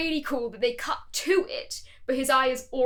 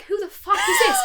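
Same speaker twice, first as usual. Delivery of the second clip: much higher shocked yell with a laugh; shocked shout; 5 dB louder than usual. Retold or imitated. Hearing the actor's real voice, high stunned laughing yell. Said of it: "I was like".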